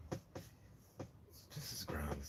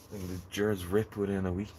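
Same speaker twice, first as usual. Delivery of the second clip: ordinary talk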